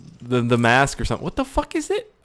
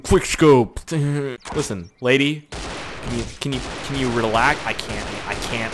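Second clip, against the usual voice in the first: normal talking voice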